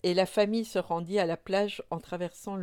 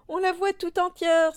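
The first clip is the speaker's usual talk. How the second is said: very high, loud cry of amazement; excited exclamation; 12 semitones higher, 7 dB louder than usual. A narrator's reading. Moumine seeing the invisible child appear, exclaiming excitedly. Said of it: "s'écria Moumine".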